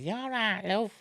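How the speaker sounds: high, hammed-up American accent on a friendly greeting. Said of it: "Bit in an American accent".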